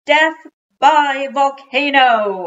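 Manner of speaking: high, loud, drawn-out play scream; screamed battle cry. Acted. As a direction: yell it like a kid leaping over lava. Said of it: "I screamed".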